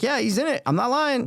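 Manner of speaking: high, earnest protest; Jack Nicky voice. Retold or imitated. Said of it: "I'm like".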